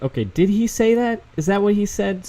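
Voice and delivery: incredulous double take. Retold or imitated. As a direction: act like you can't believe the news. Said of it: "I'm like".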